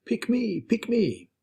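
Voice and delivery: a small, high mock yell. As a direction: pipe up high, imitating someone begging to be chosen. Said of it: "he had Hari yelling".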